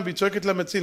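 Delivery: ordinary delivery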